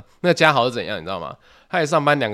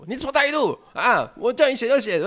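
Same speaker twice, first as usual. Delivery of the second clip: much higher, pitched-up mock yell from the kitchen; retold angry yell; 12 semitones higher than usual. Senior coworker yelling at the part-timer in the kitchen, mock yell. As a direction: yell it back the way the senior did, higher, not full force. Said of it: "他们吵到哦".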